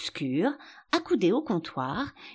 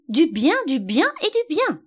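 louder and shriller with each repeat; rising squeal; 11 semitones higher, 6 dB louder than usual. A narrator's reading. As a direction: climb higher on every "bien" into a squeak. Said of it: "Sa voix se faisait plus aiguë".

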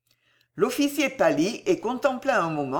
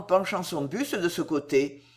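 usual speaking voice

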